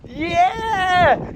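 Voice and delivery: high excited yell of triumph; cheer. Real, not acted. Cheering the family team after the tow, excited yell. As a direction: whoop it out.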